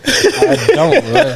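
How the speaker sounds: high laughing voice, breaking up. Real, not acted. Embarrassed about the state name, laughing high. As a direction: giggle through the words in a high squeak. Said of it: "I don't like saying that country".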